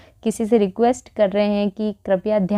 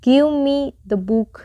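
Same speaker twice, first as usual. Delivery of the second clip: slow and higher, each word clear; slow teaching read; 4 semitones higher, much slower than usual. Shown as a demonstration. Teacher demonstrates a command to the class. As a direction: model the command slowly. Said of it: "अगला है".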